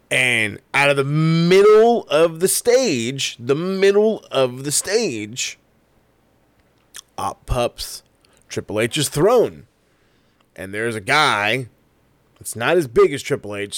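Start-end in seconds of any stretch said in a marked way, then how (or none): 0.1-9.7 dramatic build. Acted. Hyping the dramatic entrance reveal.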